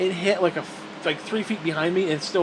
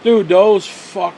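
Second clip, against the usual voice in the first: ordinary delivery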